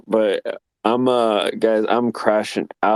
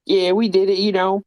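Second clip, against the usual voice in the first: mock Louisiana accent; 10 semitones higher than usual. Mocking how Louisiana folks talk, Cajun accent. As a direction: imitate a thick Louisiana Cajun voice. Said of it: "I can barely understand them half of the time".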